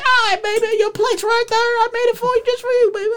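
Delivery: very high falsetto mom voice; doting mama. Retold or imitated. Mom holding her child after a nap, high doting falsetto. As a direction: coo it high like a doting mother. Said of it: "she just holds you. She's like".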